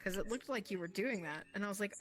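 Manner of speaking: ordinary talk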